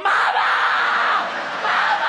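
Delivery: loud panicky calls for mama; a loud, scared shout for mama; crying for mama. Retold or imitated. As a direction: yell for your mother in fear. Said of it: "on the cockpit deck going".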